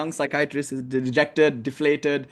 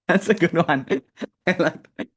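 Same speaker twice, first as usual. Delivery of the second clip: chuckling through the words; amused, laughing as you speak. Real, not acted. Laughing after joke.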